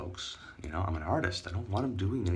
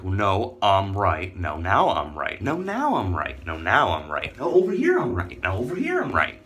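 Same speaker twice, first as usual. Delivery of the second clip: loud insistence, pitch jumping between rivals; mock bickering; 6 dB louder than usual. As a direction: act out rivals each topping the other. Mimics endless bickering over who's right.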